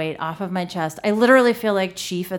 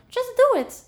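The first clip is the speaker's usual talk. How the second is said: much higher squeaky foreign voice; a tiny voice in her head urging her on; high-pitched foreign voice; 4 dB louder than usual. Retold or imitated. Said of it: "very high-pitched foreign voice go".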